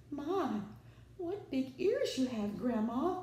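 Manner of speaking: high, strained croak; croaking wolf. A narrator's reading. Caught wolf to Grandma, nervous croak. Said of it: "the wolf croaked".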